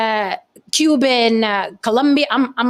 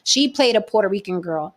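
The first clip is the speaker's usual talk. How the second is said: ordinary delivery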